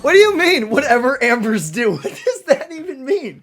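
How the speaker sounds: loud, high disbelieving laugh-question; what does that even mean, laughing; incredulous. Real, not acted. Incredulous at odd game line.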